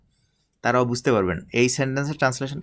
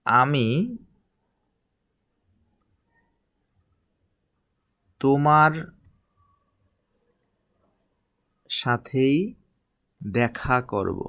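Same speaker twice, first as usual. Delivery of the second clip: slow dictation; much slower than usual. Shown as a demonstration. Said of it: "দেখুন সেন্টেন্সটা যদি".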